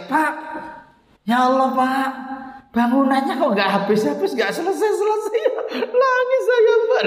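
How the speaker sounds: suara tinggi merengek, menirukan istri menangis; menirukan tangis. Retold or imitated. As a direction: rengekan tinggi setengah tertawa. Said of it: "sama Niso pun nangis". (meniru Niso mengeluh pondok tak selesai sambil merengek)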